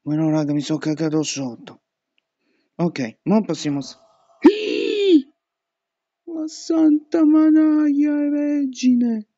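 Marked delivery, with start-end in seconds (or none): 4.4-5.2 sharp high gasp of fright; startled high gasp; frightened gasp. Real, not acted. Sudden jump scare. 6.2-9.3 high, slow frightened cry; drawn-out terrified oath; shaken exclamation. Real, not acted. After jump scare.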